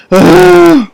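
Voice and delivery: big, high, loud groaning sigh of frustration; a fed-up sigh at the tablet; loud exasperated sigh. Real, not acted. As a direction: let out all the annoyance in one sigh. Tech failing again.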